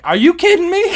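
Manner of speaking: loud, high-pitched retold disbelief; incredulous outburst. Traveler pulled aside at security a second time, incredulous. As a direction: blurt it out, voice shooting up. Stopped again, acts out his disbelief.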